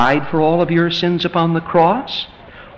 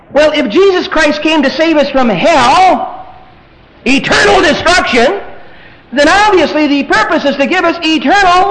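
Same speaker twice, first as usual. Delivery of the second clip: high, loud pulpit challenge; loud, impassioned sermon questioning; forceful preaching; 12 semitones higher, 6 dB louder than usual. Real, not acted.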